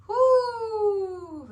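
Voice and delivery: high, drawn-out falling whoop; long "whoo". Real, not acted. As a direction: let out a long exhaling whoo. Reacts to a deep episode.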